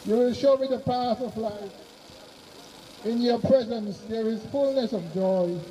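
normal talking voice

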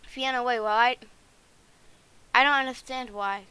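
loud pleading call after Fiona. Acted. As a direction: call out to stop her leaving. Calling after Fiona, upset.

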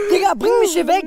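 frantic shouted demand; panicked plea. Acted. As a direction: beg to be rescued at full volume.

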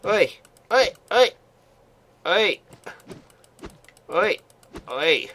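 high, loud oi calls; calling out. Real, not acted. Gamer calling to another player, loud high oi. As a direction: holler at him across the map.

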